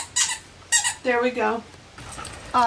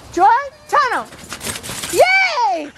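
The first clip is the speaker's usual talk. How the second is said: excited cheer; 9 semitones higher, much slower than usual. Real, not acted. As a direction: cheer for the dog. Cheering the dog.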